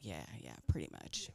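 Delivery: low, near-whispered husband voice; husband's deadpan reply. Retold or imitated. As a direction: drop way down, dry shrug of an answer.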